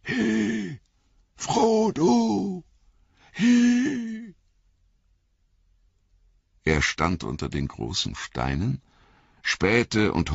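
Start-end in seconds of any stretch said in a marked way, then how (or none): 0.0-4.3 high distant shout; calling from afar. Acted. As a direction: call out from far away. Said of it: "einen Ruf zu hören".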